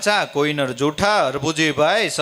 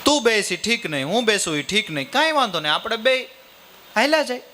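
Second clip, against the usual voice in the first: pitched much higher, lively storytelling voice; voiced character's plan; 8 semitones higher than usual. Father proposes they both walk, higher animated character voice. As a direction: lay out the plan brightly in a raised character voice. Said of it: "કે".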